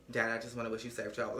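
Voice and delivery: low, soft, quick mumble; imitated subdued daughter. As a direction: voice a reluctant daughter, quietly. Voicing daughter's reluctant call.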